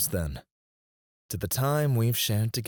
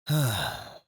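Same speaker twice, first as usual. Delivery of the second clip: sigh. Acted. Boss settling in at dinner, sighing. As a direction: let out a long breath. Boss sighs during toast chat.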